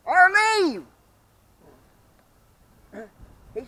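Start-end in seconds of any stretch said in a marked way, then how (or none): 0.1-0.9 high, loud order; loud high order to leave; retold yell. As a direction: bark it as a final order. Retold ordering phone talker out.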